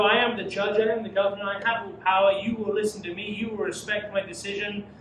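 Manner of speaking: pompous governor asserting his power; proud Pilate. Acted. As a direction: declare it like an arrogant governor. Preacher voicing Pilate's boast.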